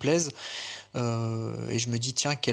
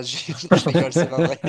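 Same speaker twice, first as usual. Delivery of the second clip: loud, laughing retraction of a tease; 3 semitones higher, 9 dB louder than usual. Real, not acted. Speaker admits to friends they were only joking, laughing loudly. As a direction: laugh it out loudly as you take back the joke.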